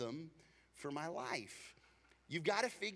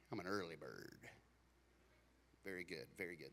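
much lower, much softer mock answer; mumbled early bird reply; 11 semitones lower, 12 dB quieter, faster than usual. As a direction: mumble it back like a half-awake crowd. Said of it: "How many of you would say".

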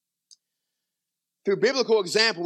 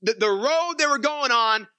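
intense preaching; 6 semitones higher, 3 dB louder than usual. Real, not acted. Preacher building on the lost.

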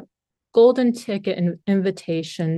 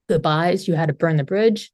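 ordinary talk

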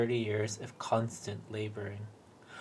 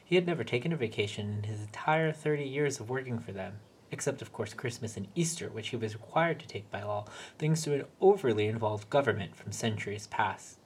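usual speaking voice

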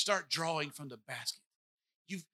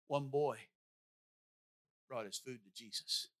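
put-on softer, held-back One boy; quiet restart; 6 semitones lower, 6 dB quieter than usual. Shown as a demonstration. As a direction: start over gently with One boy. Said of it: "No. No".

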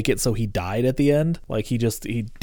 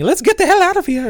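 high, hurried retold skeleton cry; panicked fleeing skeleton; 4 dB louder than usual. Podcaster quotes a favorite skeleton running away, high mock cry. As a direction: imitate a skeleton making a run for it. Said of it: "running away and saying".

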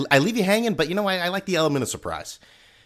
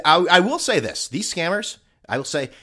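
usual speaking voice

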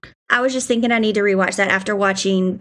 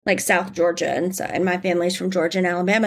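normal talking voice

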